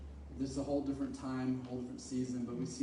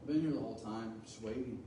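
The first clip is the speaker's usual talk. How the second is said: much softer, lower, gentle reply; Jesus answering the frightened disciples calmly; 5 semitones lower, 6 dB quieter than usual. Retold or imitated. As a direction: answer quietly, no alarm at all. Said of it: "He's like".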